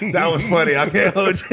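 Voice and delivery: laughing while talking; words through real laughter. Real, not acted. Cracking up at joke.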